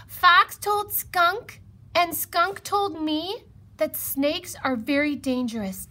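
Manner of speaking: high, loud mouse voice; worried mouse, pitched right up; anxious mouse. A narrator's reading. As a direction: pass it on with urgent alarm. Mouse passes on frightening gossip.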